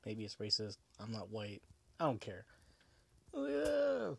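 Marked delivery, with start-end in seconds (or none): 3.3-4.1 squeaky high yeah; mock high voice. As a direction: pitch the yeah way up.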